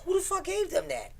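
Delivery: high, indignant cry; high shocked question; outraged disbelief. Retold or imitated. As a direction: retell the shock at the photo. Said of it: "I was like".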